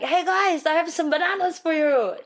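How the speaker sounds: high, sing-out offer to the neighbors; hawking free produce in a high voice; cheery call-out. Retold or imitated. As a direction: call out brightly to the villagers, pitched well up. Calling out to villagers with bananas.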